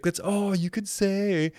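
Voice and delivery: high, slow mocking taunt; teasing sister. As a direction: tease him like a bratty sister.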